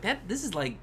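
high, awed exclamation; excited. Retold or imitated. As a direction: pitch it way up in wonder. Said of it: "And I was like".

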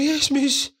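high timid schoolboy reply; meek high yes miss. Retold or imitated. To the tanning lady, a high meek schoolboy voice. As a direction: squeak it out like a nervous pupil.

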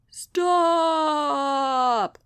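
a long, moaning cry of stop; moaned. Acted. Sloth moans at Sasha to halt the bike, drawn out. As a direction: moan it out like a complaint. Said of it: "moaned Mister Sloth".

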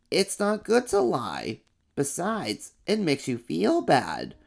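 slow, matter-of-fact little monster; second monster. Acted. Second monster says it's the same old blue fur. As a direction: state it plainly and slowly.

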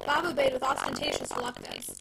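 ordinary delivery

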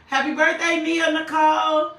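high, loud celebratory call; excited birthday shout. Real, not acted. Preacher to a listener on their birthday, excited shout. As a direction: holler the greeting out high.

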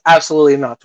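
ordinary delivery

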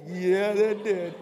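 ordinary talk

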